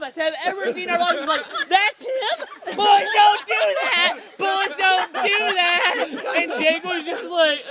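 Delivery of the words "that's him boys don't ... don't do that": mock excited shout. Store worker teasing Jake.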